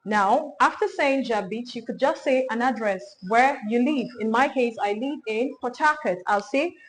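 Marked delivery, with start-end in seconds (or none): none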